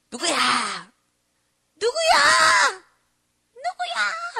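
repeated call rising to a piercing drawn-out shout; octave climbing. Acted. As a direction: call again and again, pitch soaring toward explosion. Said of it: "해서 막 옥타브가 올라가서".

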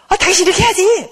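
high, loud scolding of a spouse; nagging spouse. Acted. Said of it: "막 이렇게 말했다는 거예요".

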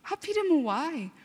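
lifted, drawn-out why to God; exasperated plea. Retold or imitated. Teen walking past strange men, exasperated plea to God. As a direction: sigh it out like a fed-up kid. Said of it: "그럴 때마다".

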